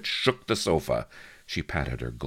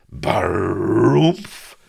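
loud, booming rolled exclamation, pitched up; explosive, relishing outburst; 5 semitones higher, 10 dB louder than usual. A narrator's reading. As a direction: boom it out, drawn long with a trill. He recalls a crude word with relish.

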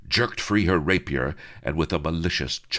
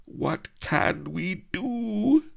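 slow, high plaintive moan; hammed-up distressed damsel; much slower than usual. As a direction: moan helplessly, drawn out. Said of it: "moaned Melliflua".

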